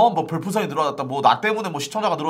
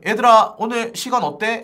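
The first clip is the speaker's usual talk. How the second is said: lightly voiced boss, pitched up; imitating the boss; 7 semitones higher, 5 dB louder than usual. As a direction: call out to the group as the boss, higher. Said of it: "라고 이렇게 딱 물어본 적이". (대표님이 묻는 말을 높은 톤으로 흉내)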